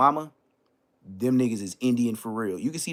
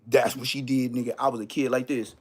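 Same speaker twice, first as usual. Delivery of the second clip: usual speaking voice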